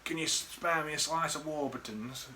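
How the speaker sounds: put-on a light take on a drunk friend asking nicely; imitating drunk mate. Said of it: "and he goes".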